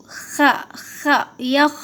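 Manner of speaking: خ، خ as isolated throat fricatives, then یخ; rasped KH. Shown as a demonstration. Teacher showing how it is read, rasped KH. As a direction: scrape the back of the throat on خ. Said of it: "اس طرح سے پڑھیں گے".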